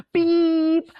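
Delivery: high sustained beep; one long high beep; imitated beep. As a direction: sound out the gadget's tone. Imitates the device again.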